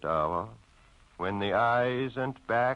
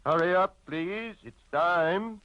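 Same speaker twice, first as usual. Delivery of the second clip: barman's call; 7 semitones higher than usual. Acted. Barman calling closing time.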